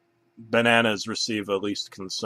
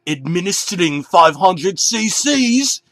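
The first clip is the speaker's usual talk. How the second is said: high, louder, slow put-on doctor; mock doctor voice; 8 semitones higher, 7 dB louder than usual. Banana posing as doctor before striking the bear. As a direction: announce it like a mad surgeon.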